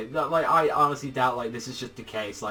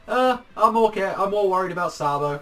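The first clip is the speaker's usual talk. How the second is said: higher, louder imitated brush-off; mock unbothered reaction; 6 semitones higher, 7 dB louder than usual. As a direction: imitate a character brushing it off, pitched up. Retold offhand reaction of Luffy.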